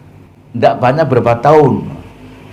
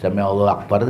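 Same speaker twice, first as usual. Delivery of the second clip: rushed mock prayer; 4 semitones lower, 8 dB quieter, faster than usual. Shown as a demonstration. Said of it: "karena cepat sekali".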